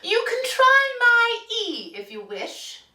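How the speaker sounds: very high, loud henny voice; clucking hen. A narrator's reading. Hen offering the duck her letter, clucky high voice. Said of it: "clucked the hen".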